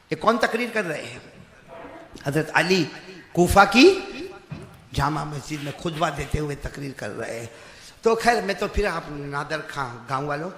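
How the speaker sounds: ordinary talk, nothing marked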